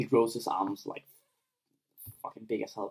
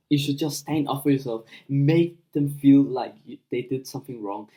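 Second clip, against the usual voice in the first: normal talking voice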